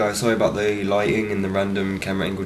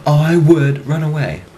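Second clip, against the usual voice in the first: comic blurt; 5 semitones higher, 5 dB louder than usual. Real, not acted. Comic punchline after pauses.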